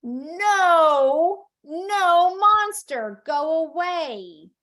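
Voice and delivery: loud, high, drawn-out command; big storytime shout, slowing at the end; playful banishing cry. Acted. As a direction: shoo a monster away for a child. Mom banishes monster, echoing child.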